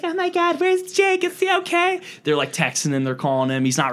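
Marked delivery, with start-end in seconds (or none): 0.0-2.0 high mock girly panic; general girl voice. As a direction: squeak it like a worried girl. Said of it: "my general girl voice".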